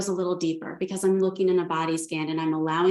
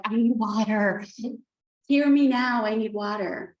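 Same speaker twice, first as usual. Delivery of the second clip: a hint of an insistent dog, higher on hear me now; demanding dog voice; 4 semitones higher than usual. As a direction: playfully voice the dog begging for water. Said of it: "Harvey's sitting there like".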